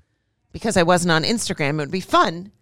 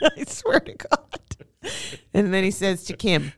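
normal talking voice

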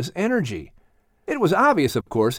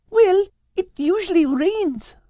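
put-on young girl voice. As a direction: ask it like a puzzled girl. Kate's line.

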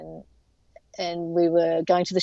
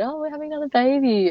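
higher, quick, bright delivery; excited announcement; 8 semitones higher, faster than usual. Retold or imitated. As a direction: announce the news to the boys with joy. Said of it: "we just said".

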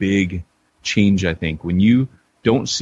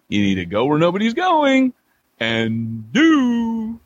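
high, swooping announcer outro; mock-grand podcast closing; hammed-up sign-off; 10 semitones higher than usual. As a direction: play up the show's catchphrase in a raised voice.

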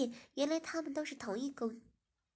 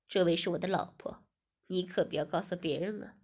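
lower, confiding man; male host voice; 5 semitones lower, 5 dB louder than usual. Acted. Host telling Ziying not to tell anyone, lower. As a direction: drop low and secretive.

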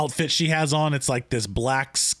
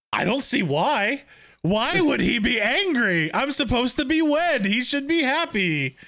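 higher, feigned puzzlement; lightly voiced innocent uncle; mock innocent protest; 7 semitones higher than usual. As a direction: play dumb, pitch rising to a squeak. Imitating Daemon's mock innocence.

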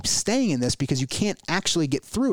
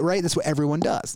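ordinary talk

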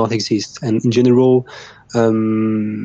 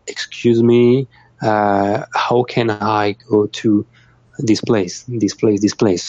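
slow and careful; slower than usual. Shown as a demonstration. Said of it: "So just".

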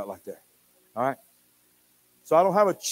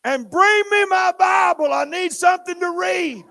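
put-on high, loud, slow little-girl yell; toddler yelling; 14 semitones higher, 7 dB louder than usual. Granddaughter yells from the bathroom to Papa, high and loud. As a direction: yell it from the bathroom like a small child. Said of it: "She yells from the bathroom".

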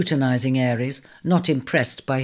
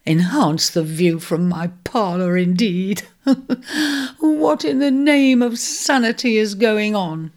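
tearful, voice catching on a sob; half-crying through indignant words; upset landlady sniffling through tears; 6 semitones higher, 3 dB louder than usual. Acted. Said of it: "she sniffled".

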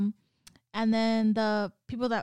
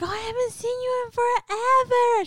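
much higher, louder mom voice; high gushing mom; 13 semitones higher, 6 dB louder than usual. Retold or imitated. Mom greeting him warmly, in a high gushing voice. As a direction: gush over him like mom. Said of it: "she was like".

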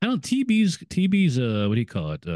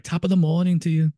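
mock Irish accent, lightly put on; a hammed-up Irish greeting, higher and brighter; 8 semitones higher, 4 dB louder than usual. Friend jokes as an Irish character arriving, mock Irish accent. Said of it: "I'm doing my Irish accent".

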